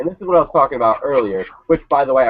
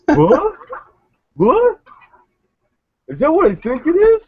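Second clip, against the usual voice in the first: much higher, hammed-up astonished gasp; mock surprise; 3 dB louder than usual. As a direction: play dumb, overacting the shock. Mocking commentators' fake surprise.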